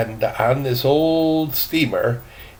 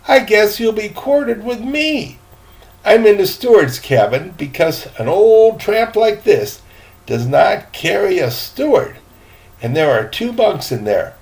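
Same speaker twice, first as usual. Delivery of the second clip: put-on high, louder boy's voice; eager boy; 8 semitones higher, 5 dB louder than usual. Boy telling the gentleman about the captain, high and eager. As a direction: play the cheerful young deckhand. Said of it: "said the boy".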